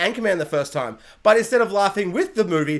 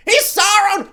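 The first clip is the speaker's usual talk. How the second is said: high, loud yell; screaming at the TV; 11 semitones higher, 6 dB louder than usual. Retold or imitated. Fan screaming at the TV, loud. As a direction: yell it at the screen. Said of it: "I literally screamed at the T V".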